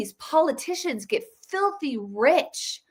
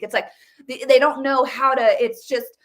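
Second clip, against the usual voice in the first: usual speaking voice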